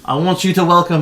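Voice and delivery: loud showman build-up; arena announcer. Acted. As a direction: hype the newcomer. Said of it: "And he goes".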